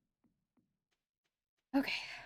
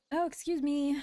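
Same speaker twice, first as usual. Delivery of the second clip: hiccups; 6 semitones higher, 3 dB louder than usual. Real, not acted. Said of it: "I have the hiccups tonight".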